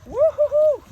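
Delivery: very high, loud woo-hoo; happy whoop. Real, not acted. Hiker about to pitch the tent, happy whoop. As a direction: sing out your joy. Celebrating at campsite.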